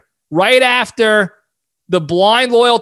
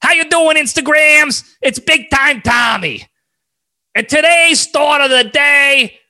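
Big Time Tommy; 7 semitones higher than usual. Retold or imitated. Host mimics Tommy's video intro for listeners, high and brash. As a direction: belt it out like a cigar-chomping showman. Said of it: "that's exactly how it goes every time".

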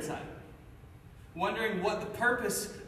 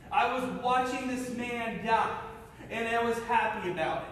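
usual speaking voice

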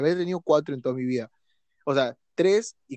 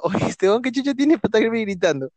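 higher, louder, mock-offended thought; inner mock indignation; 7 semitones higher, 4 dB louder than usual. Recalling being yelled at, mock-indignant inner voice. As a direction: scoff at the yelling man in your head. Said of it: "mi mente está pasando".

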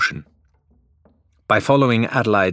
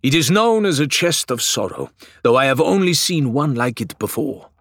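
higher, grand solemn pronouncement; proclaiming; 6 semitones higher, 3 dB louder than usual. A narrator's reading. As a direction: announce it like a learned sage. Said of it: "Faisal proclaimed".

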